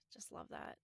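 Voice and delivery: whispered. Real, not acted. Gushing fondly.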